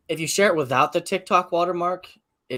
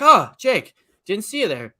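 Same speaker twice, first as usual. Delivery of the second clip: higher, louder mock-startled yell; startled scream; 6 semitones higher, 6 dB louder than usual. Re-enacting own video when someone walks in, mock startled scream. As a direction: yelp it out, then ease off slightly. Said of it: "and I scream, I'm like".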